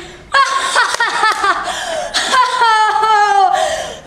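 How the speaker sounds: high, long peals of hysterical laughter; hysterical giggling fit. Acted. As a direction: laugh uncontrollably, high and near tears. Giggling fit described and performed.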